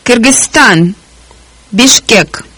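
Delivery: normal talking voice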